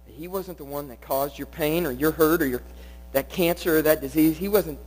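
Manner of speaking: ordinary talk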